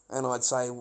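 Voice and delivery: ordinary delivery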